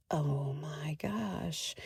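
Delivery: slow breathless whisper of wonder; a stunned slow whisper; awed whisper. Retold or imitated. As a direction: hush it out, slow and amazed. Awed at seeing [name] descend.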